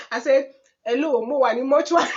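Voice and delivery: shouting through laughter; can barely get the words out for laughing; loud laughing retelling. Real, not acted. Man answers phone in bank.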